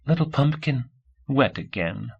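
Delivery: soft, lilting motherly voice, starting high; gentle woman's voice. A narrator's reading. Kid mimicking a woman to a baby, gently. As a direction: imitate a gentle woman cooing. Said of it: "in a gentle woman's voice".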